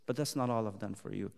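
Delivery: quiet confiding preacher; hushed. Acted. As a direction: murmur it close, x1.4. Hushed repeat.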